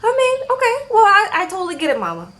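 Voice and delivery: high, fast placating voice; high mock reassurance. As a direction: rush out soothing words in a high voice.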